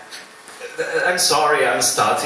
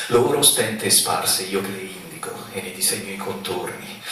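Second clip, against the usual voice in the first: poem recital; 3 semitones lower than usual. Acted. Recites own poem to father.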